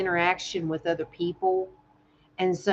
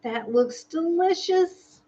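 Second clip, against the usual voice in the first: gushing over food; 10 semitones higher, slower than usual. Real, not acted.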